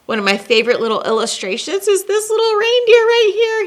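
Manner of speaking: high, quick and delighted. Real, not acted. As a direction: gush over the picture. Delighted aside about illustration.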